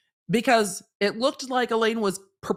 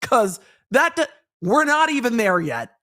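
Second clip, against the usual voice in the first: exasperated judge; 2 semitones higher, 3 dB louder than usual. Retold or imitated. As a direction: scold the lawyer like a fed-up judge. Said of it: "The court's just sitting there being like".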